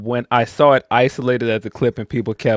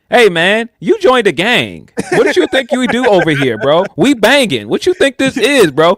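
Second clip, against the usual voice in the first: high, loud streetwise scolding; gang member; 10 semitones higher, 6 dB louder than usual. Retold or imitated. Gang member scolds Ospreay for refusing to fight, loud and high. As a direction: talk down to a new recruit like a gang member. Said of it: "it definitely felt like".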